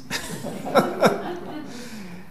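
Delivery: soft breathy laugh. Real, not acted. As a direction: laugh at the title. Laughing at paper title.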